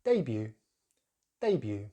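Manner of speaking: debut stressed on the first syllable, DAY-byoo; British stress. Shown as a demonstration. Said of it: "stress on the first syllable".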